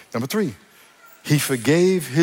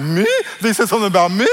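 louder, higher incredulous questions; mock shock; 4 semitones higher, 4 dB louder than usual. Churchgoer hearing gossip about themselves, mock shock. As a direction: act astonished that anyone would gossip about you. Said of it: "you might be shocked".

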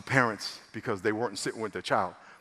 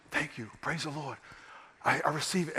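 hushed gratitude; 7 dB quieter than usual. Retold or imitated. Voicing the moved flight attendant.

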